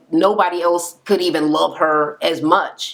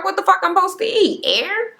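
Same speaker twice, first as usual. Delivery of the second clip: much higher, fast indignant squawk; outraged disbelief; 12 semitones higher than usual. Retold or imitated. Client protesting her food being thrown out, much higher and fast. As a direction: squeal it, offended and incredulous.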